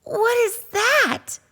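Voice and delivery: a cub gazing at the lights; awed high whisper; in wonder. Acted. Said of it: "asked in wonder".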